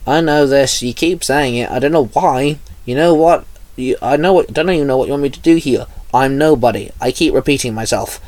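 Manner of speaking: plain reading voice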